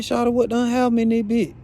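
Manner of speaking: shocked at blood on the papers; alarmed. Retold or imitated. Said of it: "So I'm like".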